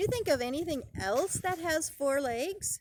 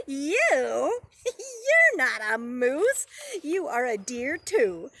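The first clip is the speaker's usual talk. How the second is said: laughing through the words, loud; laughing deer; 2 semitones higher, 6 dB louder, slower than usual. Acted. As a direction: laugh at him while you speak. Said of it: "the deer laughed".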